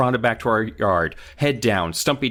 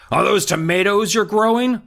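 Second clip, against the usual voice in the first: high, louder taunting comeback; shot back; 10 semitones higher, 4 dB louder than usual. Acted. Dad trading barbs with the neighbor, taunting. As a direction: needle him with it. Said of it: "Moose's dad shot back".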